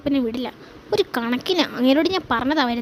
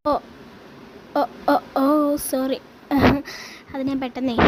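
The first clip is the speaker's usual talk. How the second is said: usual speaking voice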